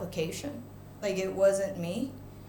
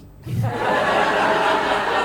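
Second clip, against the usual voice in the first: real laugh; 3 semitones lower, 6 dB louder than usual. Real, not acted. Laugh after the judge's line.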